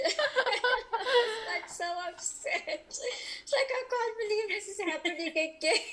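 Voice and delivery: laughing in disbelief while retelling the pregnancy test; laughing high. Real, not acted. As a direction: giggle out the disbelief.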